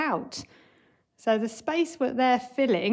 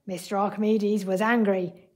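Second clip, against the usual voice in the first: ordinary delivery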